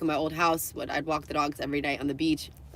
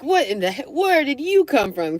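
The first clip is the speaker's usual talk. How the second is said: higher, louder imitation of a startled man; startled retort; 9 semitones higher, 6 dB louder than usual. Man startled by a stranger filming him, mock-startled outburst. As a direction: act out being spooked, stretching the question. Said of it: "scared the heebie-jeebies out of him".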